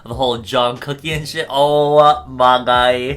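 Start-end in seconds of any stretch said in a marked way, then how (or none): 1.5-3.2 loud, very slow, stretched-out reaction; drawn-out exasperation. Real, not acted. Reacting to the whole drama scandal, drawn-out exasperation. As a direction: stretch every word in exasperation.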